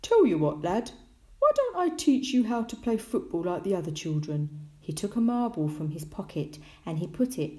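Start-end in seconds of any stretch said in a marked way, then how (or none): 0.0-4.7 hearty fatherly character voice; Tom's dad. A narrator's reading. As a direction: propose it cheerily to a young son. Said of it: "Tom's dad said to him".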